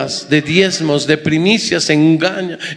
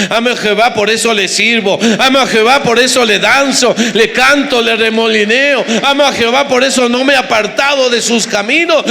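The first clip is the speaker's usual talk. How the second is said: building praise refrain; 4 semitones higher than usual. Acted. Preacher stirs the congregation by repeating his love, rising. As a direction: climb the refrain into full preaching fervor.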